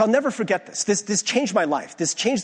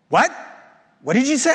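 sharp, higher, louder disbelief; a retold pastor who can't believe what he heard; outraged elder demanding a repeat; incredulous what; 7 semitones higher, 5 dB louder, faster than usual. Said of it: "he was like".

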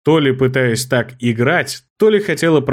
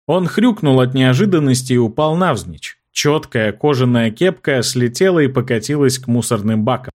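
ordinary delivery, nothing marked

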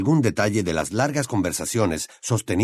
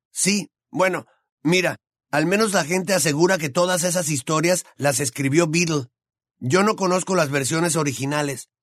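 put-on higher teasing boy's voice; higher young wizard voice; Ron's voice; 7 semitones higher than usual. A narrator's reading. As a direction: voice the gangly friend, amused.

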